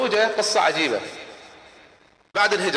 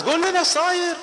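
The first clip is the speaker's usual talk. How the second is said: pleading demand; 13 semitones higher than usual. Acted. Crowd pleading for news.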